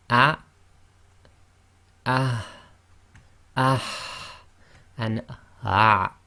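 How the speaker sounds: ah through the four throat sounds in turn; four throat letters. Shown as a demonstration. Teacher runs through the four throat letters. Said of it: "So it'll be".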